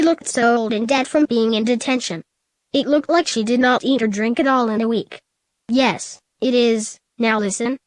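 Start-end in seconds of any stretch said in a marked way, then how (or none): none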